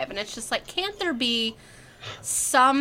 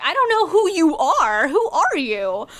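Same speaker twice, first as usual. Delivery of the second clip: baffled outburst; 7 semitones higher, 7 dB louder than usual. Retold or imitated. Retold baffled reaction, loud.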